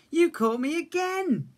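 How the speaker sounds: thrilled to be caught; little cub happy in Big Bear's arms; delighted cub. A narrator's reading. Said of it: "Little Bear said".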